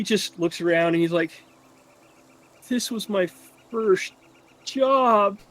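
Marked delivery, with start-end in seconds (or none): 2.7-5.4 tearful lad. Acted. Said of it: "starts crying again".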